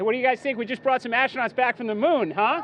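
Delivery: loud, high, joyful cheer; rally the crowd, thrilled; excited cheering. Real, not acted. Administrator rallies crowd on ship.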